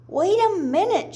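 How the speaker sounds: calling out to stop the crowd; shouted. A narrator's reading. Baby Owl shouting at the chicks to get off. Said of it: "shouted Baby Owl".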